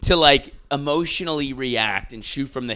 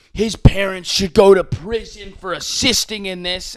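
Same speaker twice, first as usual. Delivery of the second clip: high, loud mocking voice of outraged people; mock outraged public; 6 semitones higher, 4 dB louder than usual. Podcaster mocking online mob, high-pitched outrage. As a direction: parody the online mob demanding prison.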